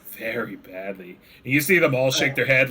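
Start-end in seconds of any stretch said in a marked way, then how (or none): 0.1-1.2 low, soft ominous aside; hushed kobold. Acted. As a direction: drop the voice, grave. Kobolds whisper.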